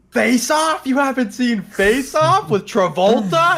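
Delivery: high, stretched-out disbelief; high-pitched comic outrage; incredulous outburst. Retold or imitated. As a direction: react as if personally scandalized. Incredulous argument over a movie.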